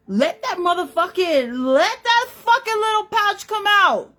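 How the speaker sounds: high, loud exclaimed plea; exclaimed urging. Real, not acted. Vlogger urging women to drop the act, high loud exclaim.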